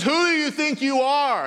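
a playful imitation of an offended wife; mock angry wife. Pastor voicing his wife's indignant demand. Wife confronting him in story.

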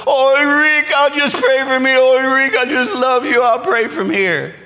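sick grandmother tenderly begging off; pleading grandma voice. Retold or imitated. Grandma on the phone tells grandson she'll pray from home, pleading. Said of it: "I said".

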